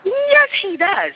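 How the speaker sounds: "Yes" held long and high; emphatic confirmation. Real, not acted. Storyteller confirms he is blind, "Yes" drawn out emphatically. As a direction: stretch "Yes, he does" slowly, high on "Yes".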